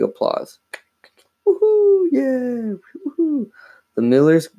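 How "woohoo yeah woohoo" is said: very high, thin whooping; high falsetto woohoos; excited cheering. Acted. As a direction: cheer like a thrilled audience. Millers give big applause.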